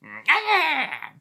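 high, drawn-out imitated whine; imitated noise. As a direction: mimic the noise in two high bursts. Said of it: "It went like this. It went".

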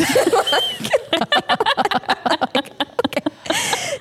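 high, long real laugh; big laugh. Real, not acted. Recalling the deputy story, high real laughter.

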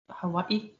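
usual speaking voice

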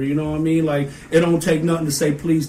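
ordinary delivery

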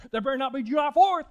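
mock-stern kid laying down the law; sassy warning. Pastor voicing Anna guarding her Texas date, sassy. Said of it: "She goes".

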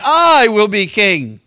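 loud, slow boast with a long I; self-exalting. Acted. Adonijah exalts himself as the next king, slow loud boast. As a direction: proclaim it grandly, stretching it out. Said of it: "He exalted himself".

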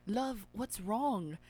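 slow, soft posh British "love"; posh British dialect, softly drawn out; British accent. A narrator's reading. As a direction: draw it out in an old-sounding British accent. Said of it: "My British dialect's so old".

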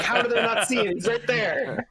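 high, laughing mock outrage; laughing disbelief. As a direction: squeak it out through a laugh. Mock viewer exclaiming, laughing.

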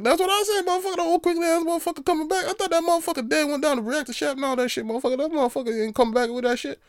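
very high, incredulous ranting; high-pitched rant. Real, not acted. Ranting about Palpatine's return, very high.

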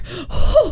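sudden high gasp from the heat; sharp gasp. Real, not acted. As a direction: gasp as the spice hits. Reacting to hot sauce.

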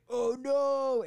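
loud, high hammed-up cry; mock alarm. Characters seeing him fly, loud mock alarm. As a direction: cry out in pretend dismay. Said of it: "they're like".

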